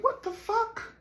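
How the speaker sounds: very high squeaked imitation; mock disbelief. Imitating Juan's reaction to the dirty car, squeaky disbelief.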